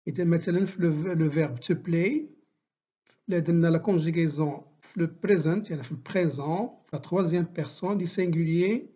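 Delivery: ordinary talk, nothing marked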